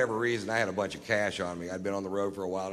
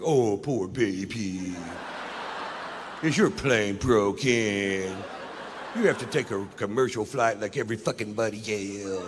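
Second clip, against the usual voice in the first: mock sympathy. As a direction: coo it sarcastically. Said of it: "They're like".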